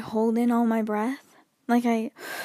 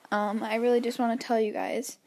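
ordinary talk

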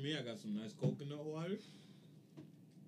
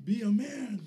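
loud, high teasing shout; hype friend cheering; 11 semitones higher, 12 dB louder, slower than usual. Real, not acted. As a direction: yell it to pump him up. Friend urging eater on.

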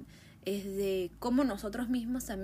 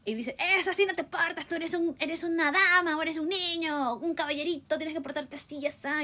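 mock scolding parent; 7 semitones higher than usual. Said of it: "Cuando te gritan".

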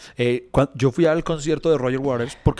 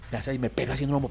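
ordinary talk, nothing marked

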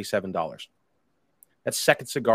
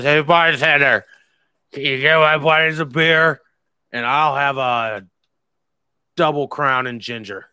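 put-on loud, higher, slowed drunk swagger; drunk buddy ordering; 4 semitones higher, 8 dB louder than usual. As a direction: call out to the bartender like a cocky drunk. Imitating drunk Tice ordering drinks.

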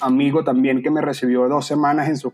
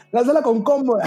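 an even higher, keener retold call to the protesters; bigger mock call-out; 10 semitones higher than usual. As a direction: repeat "lánzala" even higher, more insistent. Retelling shouting advice at protesters.

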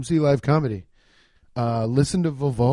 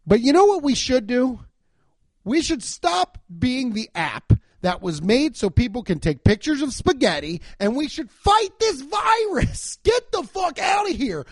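pitched way up and shouting, hammed-up fury; mock outrage; 8 semitones higher than usual. To listeners, mocking the Facebook guy with a shouted rant. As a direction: rant like a furious know-it-all, rising to a shout.

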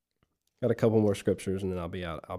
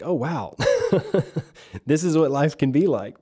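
delighted relief; 3 semitones higher than usual. Retold or imitated. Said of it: "And you're like".

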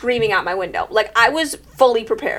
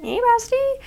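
cutesy coaxing; 13 semitones higher, 4 dB quieter than usual. Retold or imitated. Coaxing the baby raccoon.

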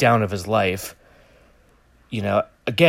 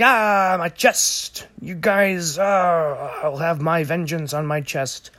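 higher hammed-up whining hero; mock pain; 7 semitones higher, slower than usual. Batman fleeing police, whining high about his chest. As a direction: overplay the wounded Batman. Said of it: "and he's like".

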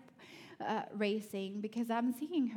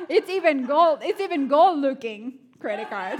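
louder, breaking up with laughter; laughing; 5 semitones higher, 15 dB louder than usual. Real, not acted. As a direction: laugh your way through it. Laughing about the gold credit card.

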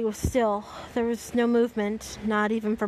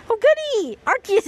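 very high, loud squeal; gleeful outburst; 11 semitones higher, 7 dB louder than usual. Real, not acted. Reader greeting the arrival of Arceus, exaggerated glee. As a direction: squeal it with showy delight.

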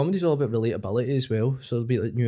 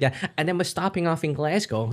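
higher, mimicked New York lady; chatty American woman, pitched up; American accent; 4 semitones higher than usual. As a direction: put on an American lady's accent. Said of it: "New York".

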